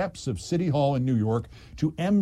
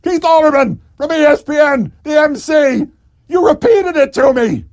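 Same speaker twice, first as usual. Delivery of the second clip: very loud, much higher furious scream; 13 semitones higher, 12 dB louder than usual. Retold or imitated. Deputy mayor screaming the name at the mayor, furious. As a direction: scream the name at him, at the end of her rope. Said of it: "Fran Reiter now screamed".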